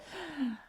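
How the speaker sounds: soft, high intake of breath; startled gasp. Acted. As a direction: gasp in sudden realisation. Bird recalls its reaction.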